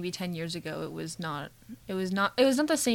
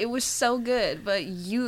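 ordinary delivery

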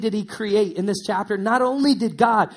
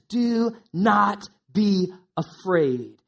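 very slow, weighty reading; solemn scripture voice; slow, solemn quote; much slower than usual. Acted. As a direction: let each word land slowly. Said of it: "And he says".

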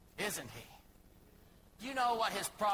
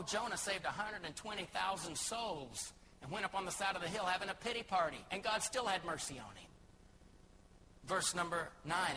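ordinary talk

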